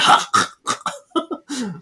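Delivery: high strangled gagging noise; acted choking hack; retching choke. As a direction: gag as if being strangled. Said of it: "That's the sound Phoebe made when she's throwing up".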